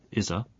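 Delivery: "isa" as one word, izza; linked words. Shown as a demonstration. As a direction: join is straight into a weak a. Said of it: "pronounced as".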